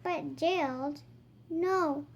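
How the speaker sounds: put-on lively read-aloud voice for kids; reading to children. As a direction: act out the book for little ones. Reading picture book dialogue aloud.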